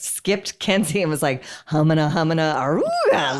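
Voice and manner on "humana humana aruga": mock ogling noise; leering catcall. Friend mimics a guy ogling a woman's legs, leering catcall. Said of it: "like, looking at her legs".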